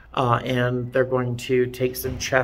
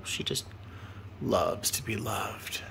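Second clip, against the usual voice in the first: tender murmur; 4 semitones lower, 12 dB quieter than usual. Real, not acted. Talking softly about the cat.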